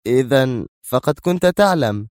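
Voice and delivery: resentful. Acted. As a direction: accuse with restrained resentment. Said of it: "مستطردة في حنق". (بحنق)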